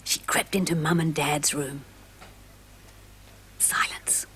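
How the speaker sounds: hushed, breathy whisper; soft secretive storyteller whisper. A narrator's reading. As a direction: whisper it as if creeping past sleepers. Sneaking into parents' room.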